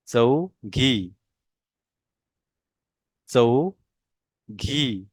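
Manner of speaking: चौघी drawn out slowly, falling; slow model pronunciation. Shown as a demonstration. Teacher models चौघी slowly for the class. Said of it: "मेरे pronunciation के ऊपर आपने ध्यान देना है".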